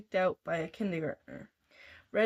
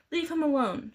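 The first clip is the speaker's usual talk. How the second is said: commanding; 7 semitones higher than usual. A narrator's reading. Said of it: "she ordered".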